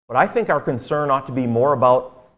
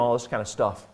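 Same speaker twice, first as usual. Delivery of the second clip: usual speaking voice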